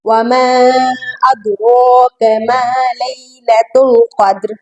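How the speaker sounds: slow tajweed recitation ending on a stopped consonant. Shown as a demonstration. Teacher showing how to pause, slow Quran recitation. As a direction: recite with long vowels, stopping on the last word. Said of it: "अगर वक्फन पढ़ें".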